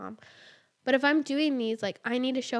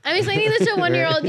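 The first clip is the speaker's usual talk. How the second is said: higher, louder, fast exclamation; incredulous emphatic echo; 8 semitones higher, 11 dB louder, faster than usual. Real, not acted. Mother echoing co-host about her one-year-old, loud and fast. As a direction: throw the words back in disbelief.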